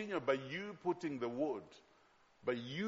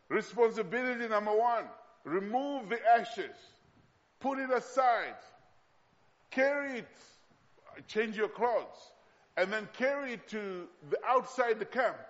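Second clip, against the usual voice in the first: emphatic preaching; 5 semitones higher, 4 dB louder than usual. Acted. Preacher gives the congregation the priest's duties, emphatic preaching. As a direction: lay down each step as an instruction.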